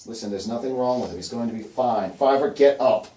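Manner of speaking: loud defence rising to an order; insistent Hazel. Acted. Storyteller as Hazel ordering Fiver up, insistent. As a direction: insist firmly, then raise the voice to command.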